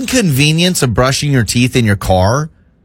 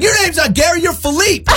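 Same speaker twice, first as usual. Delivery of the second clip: indignant. Retold or imitated. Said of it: "And I was like".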